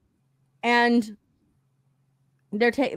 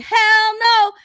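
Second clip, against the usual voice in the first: loud, high mock outburst; hammed-up outraged refusal, pitched up and louder; emphatic refusal; 9 semitones higher, 6 dB louder than usual. Retelling how he rolls out of the moving car, emphatic refusal.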